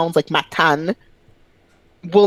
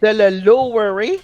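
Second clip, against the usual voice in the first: "lowerer" said as a French verb, higher; French-accented English; 4 semitones higher, 3 dB louder than usual. Retold or imitated. Quoting girl at work, French-accented English loanword. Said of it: "use English words and just with a French accent".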